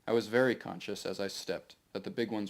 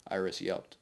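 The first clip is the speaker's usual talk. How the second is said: ordinary delivery, nothing marked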